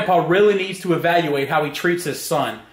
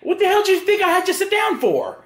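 exasperated; 10 semitones higher than usual. Real, not acted. Grandpa balks at hate comments.

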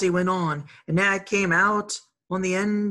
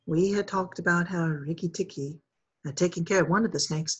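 ordinary delivery, nothing marked

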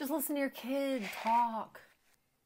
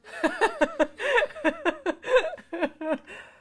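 long high-pitched giggle; squealing laugh at the screen; 4 dB louder than usual. Real, not acted. Laughing at movie scene.

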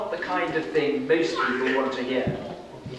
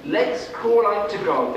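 royal decree; 6 semitones higher, 5 dB louder than usual. Acted. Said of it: "the king decreed".

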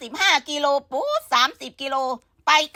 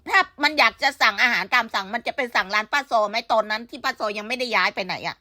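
ordinary talk, nothing marked

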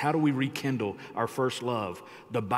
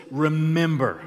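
Remember said louder, falling; emphasis on Remember; 4 semitones higher, 7 dB louder, slower than usual. Shown as a demonstration. Pastor stressing the word Remember for the church. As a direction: hit the word Remember hard. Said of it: "put some type of emphasis on the word".

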